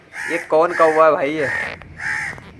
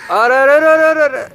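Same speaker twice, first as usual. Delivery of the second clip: startled outcry; 10 semitones higher, 7 dB louder than usual. Real, not acted. Crow suddenly flying off.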